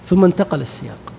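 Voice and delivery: normal talking voice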